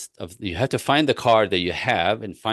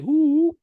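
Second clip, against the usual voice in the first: mock wife's coo; 4 dB louder than usual. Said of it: "So she would, like".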